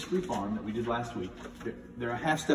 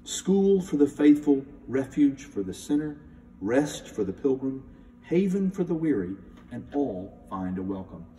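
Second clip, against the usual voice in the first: solemn hymn reading; 4 semitones higher, 5 dB louder than usual. Shown as a demonstration. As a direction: intone each line with reverence. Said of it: "I'd like to read the text".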